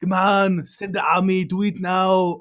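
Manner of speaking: high, loud, barking Schwarzenegger-style general; Arnold voice. Retold or imitated. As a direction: bellow orders like a movie-star Arnold. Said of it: "the reason I'm doing Arnold instead of a Scottish, uh, accent".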